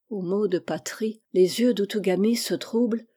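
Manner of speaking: ordinary delivery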